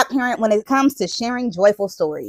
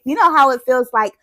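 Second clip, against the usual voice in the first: ordinary delivery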